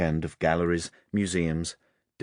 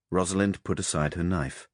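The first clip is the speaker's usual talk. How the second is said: plain reading voice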